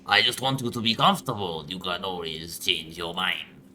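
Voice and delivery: hammed-up, shoddy French accent, slowing down; bad French accent. Player voices the hospital doctor to the patient, bad French accent. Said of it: "Should I do a shitty French accent?".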